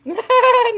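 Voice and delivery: sharp high laugh bursting out. Real, not acted. Streamer cracking up at viewer comments, high laughter. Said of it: "I'm laughing so hard".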